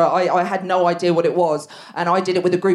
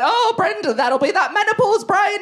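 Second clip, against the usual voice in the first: higher mock call from the sofa; mock husband call; 12 semitones higher than usual. Husband calling from the sofa, mocking shout. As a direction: toss it over the shoulder like a lazy husband. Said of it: "he shouted to me off the sofa".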